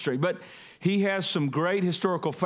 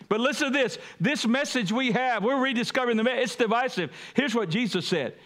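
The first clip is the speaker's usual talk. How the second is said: preaching; 6 semitones higher than usual. Acted. Preacher lifts voice again.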